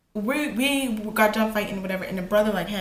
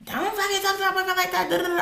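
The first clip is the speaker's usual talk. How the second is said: high-pitched mocking imitation of a mouthy girlfriend; mock squawky telling-off, pitched way up; girlfriend running her mouth; 11 semitones higher, faster than usual. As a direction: put on a high, jeering girlfriend voice. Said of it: "running her mouth".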